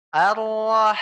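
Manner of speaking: Ar-Rah with the h breathed out fully; breathy h. Shown as a demonstration. Ustaz demonstrating the exhaled ha. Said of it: "dihembuskan juga nafasnya".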